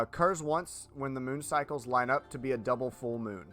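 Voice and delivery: ordinary delivery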